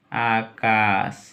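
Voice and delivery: Akash very slow as "aa-kaas"; slow dictation. Shown as a demonstration. Teacher repeating for those who don't know, slow syllabic dictation. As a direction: stretch both syllables of Akash.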